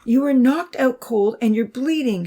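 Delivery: high, emphatic plea; begging. A narrator's reading. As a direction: beg him to see sense. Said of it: "she begged".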